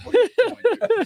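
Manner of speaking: high-pitched mock yelling. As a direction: shriek it in mock outrage.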